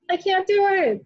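high-pitched mock panic; mock scared squeal. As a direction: squeal it like zombies truly rattle you. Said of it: "I'm like".

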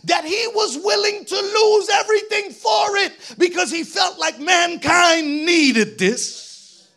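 loud, climbing preacher's shout; full-force preaching. Acted. Preacher telling the congregation what Paul gave up, full-force preaching. Preacher climaxing on Paul's sacrifice.

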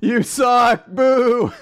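high, loud mock jeering; hammed-up crowd heckle, drawn out; heckling. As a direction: boo the performer off the stage. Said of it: "She starts hackling him".

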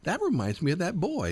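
high imitated Southern accent. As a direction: imitate a lady's Southern drawl. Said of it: "you have to hear her in her southern, like, drawl kind of accent".

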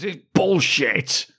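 high, drawn-out heckle; old Agnes standing up to yell; imitated outraged cry, slow and high; mock shout. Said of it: "stands up and just shouts".

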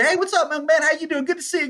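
high, bright hello to everyone; overly friendly hallway greeter; cheery greeter. Retold or imitated. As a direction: wave and greet like everyone's your buddy.

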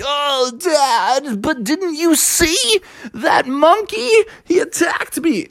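high, slow, whimpering little bear; lightly voiced little bear, whining; whiny young bear. Bubby tells his dad about the monkey, lightly whiny.

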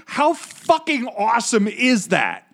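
high loud slow gush; excited. Real, not acted. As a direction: burst out thrilled. Thrilled after reading blurb.

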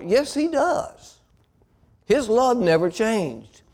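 slow, high, tender reassurance; reassuring. Acted. Pastor comforting the troubled child, slow and high. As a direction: gently insist the father still loves.

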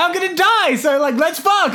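very high, laughing imitation of urgency; mock pleading. Dying man propositions his date, mock urgent plea.

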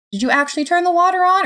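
high, incredulous question; sceptical challenge. A narrator's reading. Narrator doubts Death really showered, incredulous.

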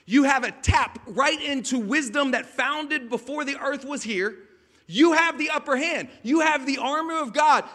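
loud, rising sermon climax; preaching at full force. Acted. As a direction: drive the truth home to the congregation.

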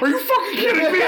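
high, squealing through laughter; laughing disbelief. Retold or imitated. Fan reacting to Groot's last line, high laughing disbelief. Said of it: "And you're like".

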